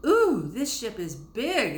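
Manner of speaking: high, slow, wide-eyed wonder; excited exclamation. A narrator's reading. Girl marvelling at the big ship, excited. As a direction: gasp it out in awe at the size. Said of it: "exclaimed Fanny".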